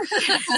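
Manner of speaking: a quick burst of real laughter, pitched high; short high laugh. Real, not acted. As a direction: laugh at the punchline of your own story. Laughs at own story.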